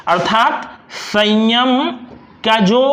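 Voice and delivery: ordinary talk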